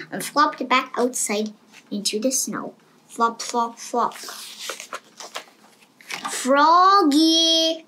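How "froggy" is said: long loud high call; mother calling again. A narrator's reading. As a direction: call him from across the house. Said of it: "called his mother".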